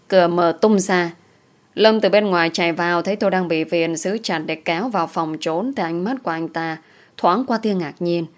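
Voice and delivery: ordinary delivery, nothing marked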